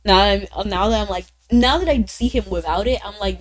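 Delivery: ordinary talk, nothing marked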